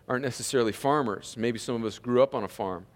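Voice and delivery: ordinary talk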